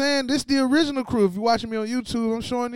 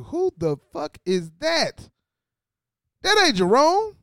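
shocked disbelief; 4 semitones higher than usual. Retold or imitated. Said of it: "and I said".

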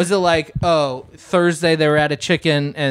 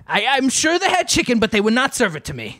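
higher, indignant mock British caller; angry but British; 7 semitones higher than usual. British Yosef complains to the cop in a higher, indignant mock British accent. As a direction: huff about the missing chicken. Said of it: "angry, but British".

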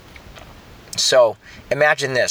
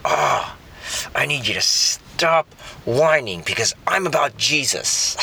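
sarcastic parent; 2 semitones higher than usual. Retold or imitated. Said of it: "I whispered into my wife's ear".

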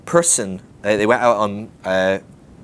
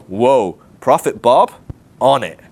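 louder mock awe; impressed crowd; 7 dB louder, slower than usual. Congregation marvelling at Prophet Bob, loud mock amazement. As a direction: gush like an impressed churchgoer.

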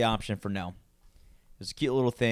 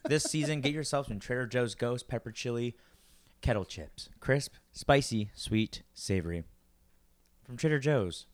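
ad read; 3 dB quieter than usual. Pretend. Mock sponsor ad read.

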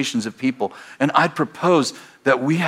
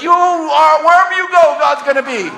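preaching at full force; 14 semitones higher, 6 dB louder than usual. Real, not acted. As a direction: proclaim it to the whole room. Preaching climax.

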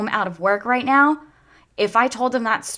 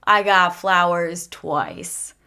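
slow emphatic repeat; 6 dB louder, much slower than usual. Real, not acted. Repeating it to viewers for effect, very slow and deliberate. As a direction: drag out "I got flowers twice" very slowly, each word weighed.

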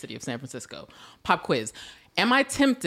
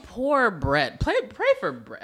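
normal talking voice

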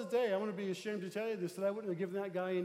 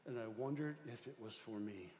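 quiet retold confession; 6 semitones lower, 9 dB quieter than usual. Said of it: "the guy says".

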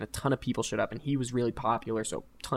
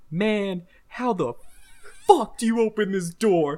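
put-on drunk frustration; 8 semitones higher, 6 dB louder than usual. Drunk friend struggling with door.

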